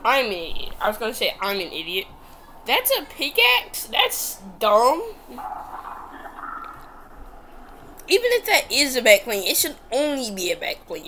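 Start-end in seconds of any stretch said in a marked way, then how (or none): 2.7-3.8 much higher, slow disbelief; stunned that it's a pickaxe; surprised. Real, not acted. Shocked at an item skin. 3.9-11.1 annoyed. Real, not acted. Ranting about the item skin.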